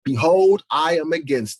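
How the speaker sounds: loud booming Behold; preaching shout. Real, not acted. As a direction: thunder the opening word. Preaching the scripture forcefully.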